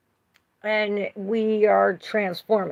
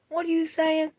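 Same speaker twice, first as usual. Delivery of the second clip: much higher, mock-outraged question; incredulous; 9 semitones higher than usual. Real, not acted. Asking chat what they mean, higher and incredulous. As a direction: squeal it in disbelief.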